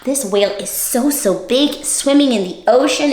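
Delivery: usual speaking voice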